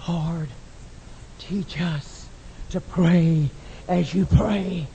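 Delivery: low, slow, soft prayer; hushed plea. Acted. Disciples ask to learn to pray, hushed plea. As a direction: drop right down and pray it gently.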